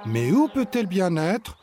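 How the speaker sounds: put-on worried child. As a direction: sound puzzled and a bit worried.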